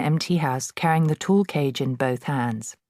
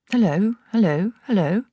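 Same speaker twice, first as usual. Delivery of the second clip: higher parrot squawk; talking parrot; 6 semitones higher, 4 dB louder than usual. A narrator's reading. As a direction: chirp it like a bird copying people. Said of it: "exactly like a human voice".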